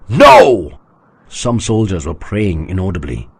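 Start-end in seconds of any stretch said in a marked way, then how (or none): 0.1-0.7 loud shouted no; crowd's answer. A narrator's reading. Soldiers answering their god, shouted no. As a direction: shout back as one.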